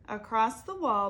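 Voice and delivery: usual speaking voice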